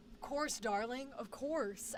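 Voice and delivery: soft, soothing guy voice; charmed boys. Acted. Boys giving in to the girl, charmed and soft. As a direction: answer gently, indulging her. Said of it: "all them boys will be up and they're like".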